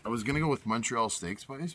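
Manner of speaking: ordinary delivery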